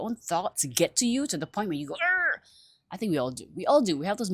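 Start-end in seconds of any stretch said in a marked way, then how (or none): 2.0-2.4 high-pitched exasperated "arr"; drawn-out cry of exasperation; frustrated growl. Acted. Acts out frustration at own thoughts.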